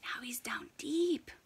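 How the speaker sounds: hushed whisper. A narrator's reading. Narrator aside to kids as penguin dives, whispered. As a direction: breathe it like a secret.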